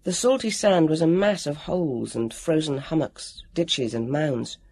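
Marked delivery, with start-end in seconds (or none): none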